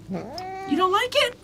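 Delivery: very high, slow, soft question to the cat; high pet voice. Real, not acted. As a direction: ask the pet gently way up high. Cat refuses the treat.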